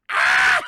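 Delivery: loud low scream; hammed-up death yell; mock death scream. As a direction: die dramatically. Imitating Sally's death cry.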